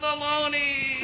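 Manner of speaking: high, slow, muffled baloney; a tiny voice escaping a hand; delayed trapped voice. Shown as a demonstration. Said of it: "Let's try a word like, uh, baloney".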